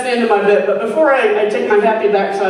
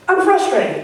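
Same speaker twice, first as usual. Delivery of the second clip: ordinary talk, nothing marked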